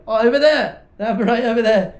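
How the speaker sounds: loud, high call across a distance. Acted. Calls out pointing, mid story.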